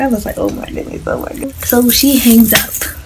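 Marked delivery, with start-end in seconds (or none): 0.4-1.7 panicked whisper. Retold or imitated. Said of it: "I was freaking out in my mind".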